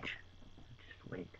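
soft, secretive whisper; whispered aside. Acted. Dutchman plotting his answer.

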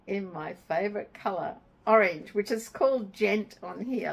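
usual speaking voice

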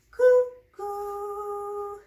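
high, loud cuckoo call; bright high cuckoo for the baby. Acted. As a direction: sing out like a cuckoo clock. Cuckoo clock strikes.

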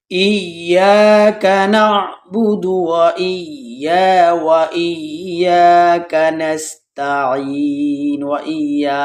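slow, drawn-out tajweed chant; slow melodic Quran recitation. Shown as a demonstration. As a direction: intone each word slowly. Teacher recites Quran slowly.

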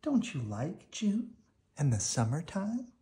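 put-on high, lively child question; curious children. The twins asking Woody about summer, high and curious. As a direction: ask in sincere puzzlement.

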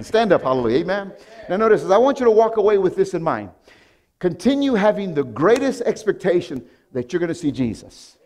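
loud high climax; rally the church to stand; preaching at full force. Acted. Pastor closing the sermon, loud climactic preaching.

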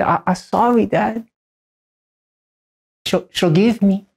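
put-on high little-girl voice; imitating a small daughter's broken sorry; toddler apology. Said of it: "She said".

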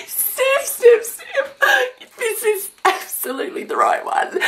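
very high squeal between laughing and crying; tearful joy. Real, not acted. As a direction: squeak it out through happy tears. Overjoyed at the right gift.

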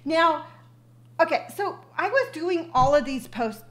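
normal talking voice

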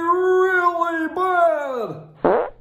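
high, very slow disgusted "really bad"; drawn-out disgust. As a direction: stretch it out, pulling a face at the smell. Exaggerated stinky emphasis.

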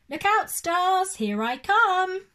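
high, loud froggy war cry; excited call. Acted. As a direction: launch yourself at the stars with glee. Frog launches into the sky.

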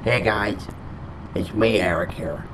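ordinary talk

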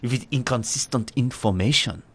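hammed-up German official reading out rules; German accent. Player finishing the ministry letter aloud, mock German accent. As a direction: put on a German accent for the instructions. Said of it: "I is becoming German again".

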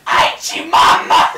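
very loud, drawn-out comic shout; over-the-top slow yell; mock holler. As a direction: bellow it as a parody. Mimics the shouted play acting.

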